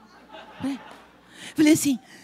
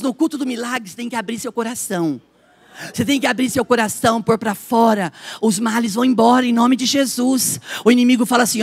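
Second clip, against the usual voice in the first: ordinary delivery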